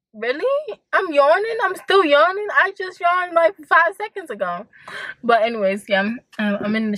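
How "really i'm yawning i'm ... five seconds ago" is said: high, incredulous at her own yawning; mock disbelief. Alone in the car, incredulous at her constant yawning. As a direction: act shocked she is still yawning. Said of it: "And I'm like".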